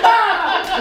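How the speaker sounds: high, loud scream with laughter in it. Acted. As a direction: scream in comic terror, half laughing. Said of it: "Said the draper".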